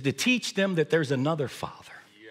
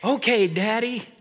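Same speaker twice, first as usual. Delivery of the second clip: put-on kid voice; 9 semitones higher than usual. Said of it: "kids would say".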